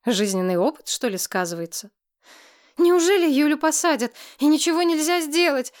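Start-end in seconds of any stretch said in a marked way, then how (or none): 2.8-5.7 high, loud tearful plea; crying on the phone. A narrator's reading. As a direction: sob it out down the phone. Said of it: "рыдала в трубку Сентябрина".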